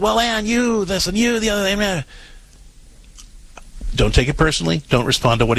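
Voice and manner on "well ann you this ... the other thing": high-pitched mock blaming rant; much higher, run-on mimicked complaint; blaming husband. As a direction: imitate a husband griping and blaming. Voicing the blaming husband's first reaction.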